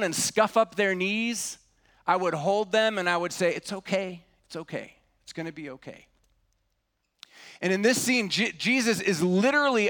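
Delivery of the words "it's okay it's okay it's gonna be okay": soft, hushed comfort, fading softer; soothing a child. Retold or imitated. Father holding his hurt child, soft soothing whisper-quiet voice. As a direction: gently hush a crying toddler.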